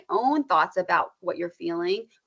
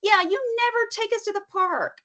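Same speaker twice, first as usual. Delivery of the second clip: put-on high whining kid voice; whiny child; 13 semitones higher, 3 dB louder than usual. Mom imitating a child denied the park, whining. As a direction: play the upset child begging to go.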